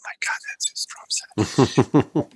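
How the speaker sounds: breathless whisper, slowed down; awed whisper. Retold or imitated. Band mates whisper in awe at [name]'s drum set. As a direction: hush it so the guide won't hear. Whispering behind the guide's back.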